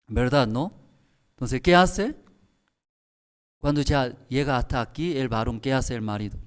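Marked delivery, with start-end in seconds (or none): none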